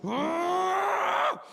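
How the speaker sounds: long, high roar of rage; enraged scream. Acted. Grown son exploding at his mum, enraged scream. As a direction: hold a rising, full-voiced scream.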